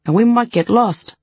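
scared of getting lost; nervous. Acted. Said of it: "Daisy sounded nervous".

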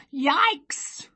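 high startled yowl shaped into a word; cat yowling as it bolts from the shed; cat's yelp. A narrator's reading. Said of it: "a cry that sounded remarkably like".

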